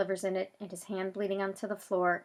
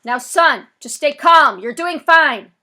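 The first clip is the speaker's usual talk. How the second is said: loud, higher calming shout; loudspeaker call; 7 semitones higher, 17 dB louder, slower than usual. Acted. Police officer calling to Zach to stay calm. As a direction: project it to a kid across the lot.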